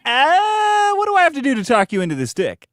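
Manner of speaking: squeaky high then fast pitch; hammed-up sleazy come-on; mock squeaky creep. Mock voicing sleazy guy.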